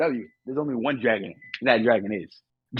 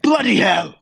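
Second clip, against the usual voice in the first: higher, mock British bloody hell; British exclamation; 7 semitones higher, 4 dB louder, slower than usual. Friends trading British catchphrases, mock British accent. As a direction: blurt it in a put-on British accent. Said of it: "I can't do a Gordon Ramsay".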